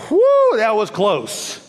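long, high whew of relief; slow, exaggerated sigh of relief; acted relief. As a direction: play up a narrow escape. Acting a believer's narrow escape.

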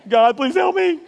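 high, loud half-laughing plea; panicked prayer. Pretend. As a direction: play a student begging God before an exam. Mock panicked prayer before tests.

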